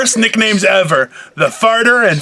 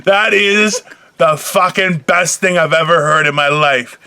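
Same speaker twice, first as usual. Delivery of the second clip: usual speaking voice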